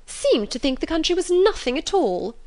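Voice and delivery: high, affronted mother voice; indignant fussy mother. Acted. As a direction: huff it out, pitch soaring. Mrs Bennet needles Darcy.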